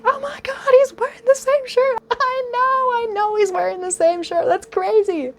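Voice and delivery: very high shrieking friends; high gushing fangirl; fangirl squeal. Retold or imitated. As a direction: squeal in disbelief. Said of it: "they're like".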